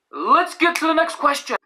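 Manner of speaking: high, sing-out catchphrase; announcer-style segue. Pretend. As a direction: launch the next segment in a high voice. Host's catchphrase segue between questions.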